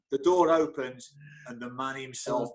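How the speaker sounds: ordinary talk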